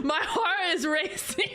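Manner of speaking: high, slow, laughing words; laughing from fright. Real, not acted. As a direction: gasp it out through shocked laughter. Startled by loud stream alert.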